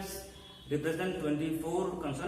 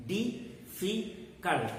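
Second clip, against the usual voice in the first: DIFFICULT slowly broken into di-fi-cult; syllable by syllable; 4 dB quieter, much slower than usual. Shown as a demonstration. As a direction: pause between each syllable of DIFFICULT. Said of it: "divide the sounds into four parts".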